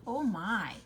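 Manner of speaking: ordinary talk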